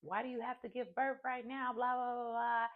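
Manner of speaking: high-pitched imitation of a panicked mother; mock hysterical mom.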